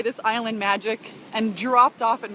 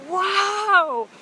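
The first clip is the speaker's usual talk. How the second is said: friend's happy wow; 8 semitones higher, 5 dB louder than usual. Retold or imitated. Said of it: "And he was like".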